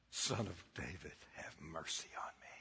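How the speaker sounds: hushed, whispered plea for mercy; whispered prayer. Shown as a demonstration. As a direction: breathe it out as a quiet prayer. The God-stopping prayer, said softly.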